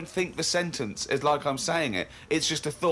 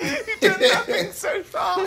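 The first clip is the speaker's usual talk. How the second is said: high and slow through laughter; laughing; 4 dB louder, slower than usual. Real, not acted. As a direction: drag it out while cracking up. Laughing at the diary.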